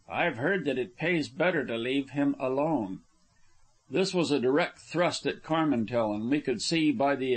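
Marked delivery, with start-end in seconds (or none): none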